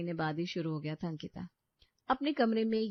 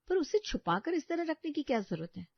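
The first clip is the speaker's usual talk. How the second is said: accusing question; 7 semitones higher than usual. Acted. Wife confronts about hidden phone.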